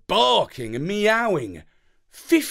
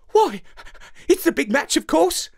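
much higher, bright dog-lady voice; a pretty labradoodle answering a newcomer; curly dog lady replying; 12 semitones higher, faster than usual. A narrator's reading. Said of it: "she replied".

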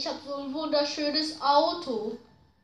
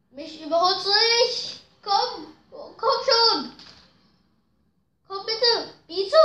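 desperate pleading; 5 semitones higher, 5 dB louder than usual. Real, not acted. Pleading with a rival racer.

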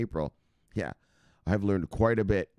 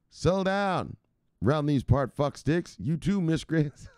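high, drawn-out scolding of a rowdy crowd; playful high-pitched wrangler's yell; mock yelling; 6 semitones higher, slower than usual. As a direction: holler slowly at the troublemakers, hammed up. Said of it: "what's he yelling at them".

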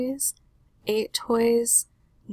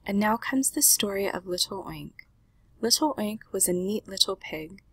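ordinary delivery, nothing marked

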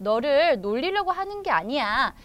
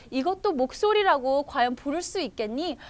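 usual speaking voice

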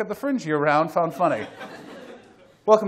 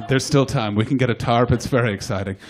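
mocking flat enthusiasm; 7 semitones lower, 5 dB louder than usual. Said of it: "a lot of enthusiasm in his voice".

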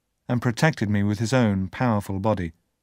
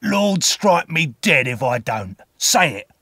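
slow growling order, higher and louder; menacing growl, punched hard at the end; growled command; 4 semitones higher, 4 dB louder than usual. A narrator's reading. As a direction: growl the oath as a threatening man. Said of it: "the man growled".

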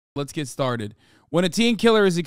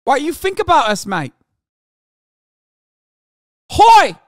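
put-on loud, much higher New Zealand accent building to a yell; a rowdy Kiwi bloke in a New Zealand accent, louder and louder; mock Kiwi accent; 12 semitones higher, 8 dB louder than usual. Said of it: "That's what he said".

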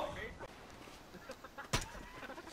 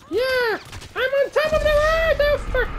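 much higher, gleeful whoop that flips to dismay; ecstatic yell; 12 semitones higher than usual. Real, not acted. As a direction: yell it from the top of the world. Exulting then crashing in game.

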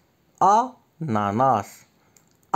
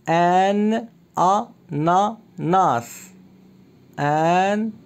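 slow, higher, each syllable drawn out; split into syllables; 5 semitones higher, much slower than usual. Shown as a demonstration. Teacher to young pupil, clapping out each syllable slowly. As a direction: count the syllables slowly for the child. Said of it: "Entoure la syllabe".

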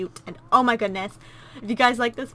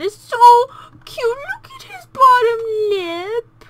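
high, slow squeal at a pout; 13 semitones higher, 12 dB louder than usual. Real, not acted. Squealing at the crying baby's bottom lip. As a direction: coo over a trembling lip. Baby pouting.

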